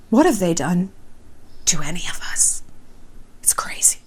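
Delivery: whispered aside; hushed, conspiratorial whisper. Real, not acted. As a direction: drop to a breathy whisper.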